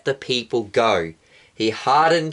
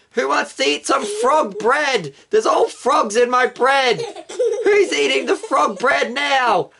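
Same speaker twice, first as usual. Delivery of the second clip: laughing frog bread; 10 semitones higher than usual. Acted. Egyptian mocking the frog bread.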